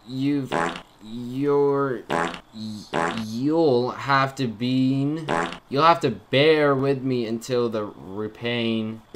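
slow, halting reading with drawn-out words; struggling to read. Real, not acted. Gamer reading a cursive note aloud, slow and halting. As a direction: stumble slowly through hard-to-read handwriting. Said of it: "I can't read cursive".

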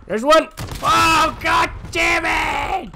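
high, slow, drawn-out angry yell; frustrated shout. Real, not acted. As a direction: drag out the curse in anger. Frustrated at dying in game.

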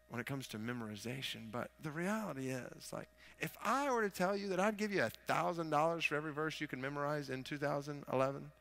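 ordinary talk

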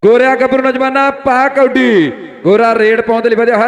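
loud, urgent raid call; frantic rural sports commentary. Acted. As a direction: call the raid with full energy.

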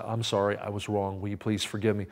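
low, soft admission of fault; retold humble apology; contrite apology. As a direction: quietly ask forgiveness. Quotes his own repeated apologies.

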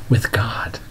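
slow, soft whisper. Real, not acted. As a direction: breathe it out slowly as a secret. Intimate whispered climax.

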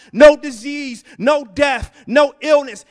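shouted at full force. Acted. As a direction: fling it out over the crowd. Preacher building to climax.

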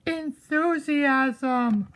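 Enthusiasm stretched very slowly; drawn-out word. Shown as a demonstration. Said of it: "You just need to curb your enthusiasm".

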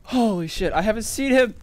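loud, high, rattled exclaiming; real startled disbelief; shocked outburst. Real, not acted. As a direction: blurt it out, shaken by the scare. Just after a jump scare.

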